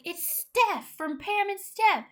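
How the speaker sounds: very high, loud, slow starstruck insistence; fangirling. Retold or imitated. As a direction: gush it like an overexcited fan. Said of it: "like, fangirling".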